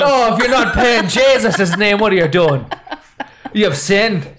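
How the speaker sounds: high, indignant mock-Irish priest; Irish accent. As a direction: condemn the sinner in hammed-up Irish. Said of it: "Did he have an Irish accent?".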